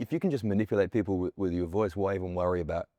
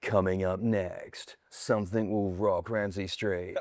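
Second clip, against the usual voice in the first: dark soap promo; 2 semitones lower, slower than usual. Shown as a demonstration. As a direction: draw it out darkly to tease the drama. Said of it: "down in this kind of darker blue zone".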